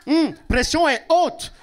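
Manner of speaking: put-on African doctor's accent. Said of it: "Il parle". (imite légèrement l'accent d'un médecin africain)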